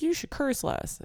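high-pitched mock scold from an older aunt. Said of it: "older women in my life, like my aunts and stuff, be like".

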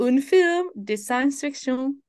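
"un film de science fiction" slow, in a put-on French accent; slow and precise, in a put-on French accent; mock French accent. Shown as a demonstration. Repeating the app's phrase, slowly in a mock French accent. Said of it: "learn how to say".

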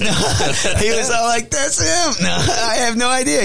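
cracking up, voice soaring high; real laughing. Real, not acted. Storyteller answering friend's burrito joke, helplessly laughing. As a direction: talk through high-pitched giggles.

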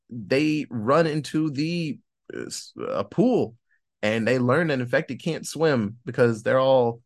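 normal talking voice

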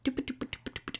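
soft, unvoiced doop-a-doop patter; idle doop-doop noises. Real, not acted. Host looking through her notes, idle soft doop-a-doop. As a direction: tick through nonsense syllables under your breath.

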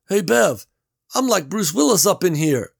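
Gary calling through the wall; Gary's voice. A narrator's reading.

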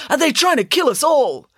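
high, loud panicky question; alarmed. Acted. Boy fears the additive is poison, alarmed. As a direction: blurt it out in rising alarm.

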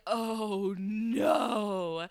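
drawn-out groan of dread; retold sinking horror; mortified dismay. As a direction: let the words sag in dismay. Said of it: "I was just mortified".

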